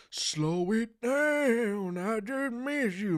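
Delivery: high, very slow put-on voice; Frank Stacks impression. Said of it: "spot-on impression of the goat Frank Stacks".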